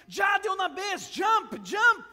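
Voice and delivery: urgent shouts from the street; crowd shouting. Acted. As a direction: bystanders yelling jump. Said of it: "de otras personas gritando también".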